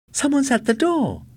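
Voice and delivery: high, loud and eager; thrilled someone is calling; excited cry. A narrator's reading. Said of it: "Sally cried excitedly".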